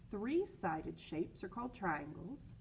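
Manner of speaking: plain reading voice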